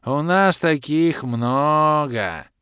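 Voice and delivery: slow, careless brush-off; offhand. A narrator's reading. The bald elf shrugging off the loss. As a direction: wave it away lazily. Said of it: "Небрежно отмахнулся лысый эльф".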